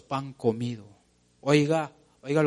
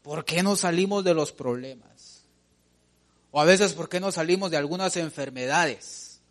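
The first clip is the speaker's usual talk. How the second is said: usual speaking voice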